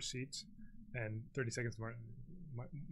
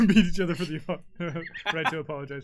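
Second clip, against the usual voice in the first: laughing; 3 semitones higher, 13 dB louder, faster than usual. Real, not acted. Joking about Canadians apologizing.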